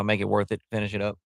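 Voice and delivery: ordinary delivery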